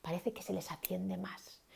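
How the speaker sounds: storyteller whisper; whisper demo. Shown as a demonstration. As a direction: whisper it as if kids lean in. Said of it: "cuando hablan flojito".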